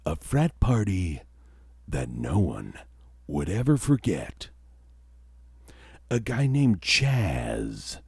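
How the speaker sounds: slow, hushed ghost-story narration; spooky storyteller. Acted. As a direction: draw out each phrase like a campfire tale. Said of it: "I'll just do it in a regular accent".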